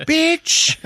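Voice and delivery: very high, loud mock shriek; outraged yell. Shot man curses her after the empty click, outraged yell. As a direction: shriek the insult in hammed-up outrage.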